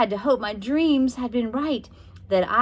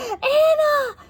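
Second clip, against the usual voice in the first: high, loud crying yell; anguished yell; 6 dB louder than usual. A narrator's reading. Josie finds her sister turned into a doll. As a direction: cry out her sister's name. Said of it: "I yelled".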